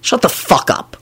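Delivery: understated anger, held in; mildly angry, without shouting; angry reply. A narrator's reading. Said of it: "Lupin replied angrily".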